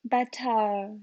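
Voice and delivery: "Better" ending in an open "uh"; dropped R. Shown as a demonstration. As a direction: drop the R from "Better". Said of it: "I always ignored the R's".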